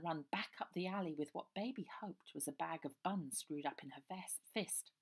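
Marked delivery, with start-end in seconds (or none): none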